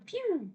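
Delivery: high mouth gunshot; high playful pew. Retold or imitated. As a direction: fire a toy-gun pew. Mimicking a gunshot.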